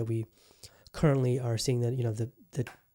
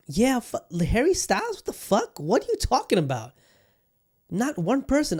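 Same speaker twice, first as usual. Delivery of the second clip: incredulous outburst; 8 semitones higher than usual. Real, not acted. Host reacting to [name] winning album of the year, outraged disbelief. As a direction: explode in disbelief at the award.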